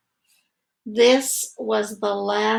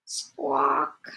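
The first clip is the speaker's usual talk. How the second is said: soft squawk; 4 semitones higher, 5 dB quieter than usual. Acted. Bird noise in story.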